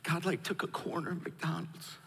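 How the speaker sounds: fighting back tears while telling it; emotional, barely holding it together; choked up. Real, not acted. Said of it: "I'm a mess like I am right now".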